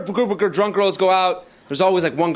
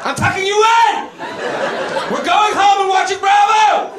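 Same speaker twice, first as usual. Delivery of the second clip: high-pitched, hammed-up parental shouting; mock furious scolding; 13 semitones higher than usual. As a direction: lay down the law as papa bear, yelling. Parodies a male mama bear.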